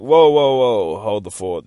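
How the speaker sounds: acted-out startled objection; loud protest. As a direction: cut in sharply to halt them. Retold protest at being accused.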